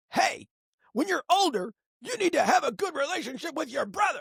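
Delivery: hammed-up nagging dad telling the kid what to do; dad lecturing son, high and preachy. Shown as a demonstration. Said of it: "that's different than me going".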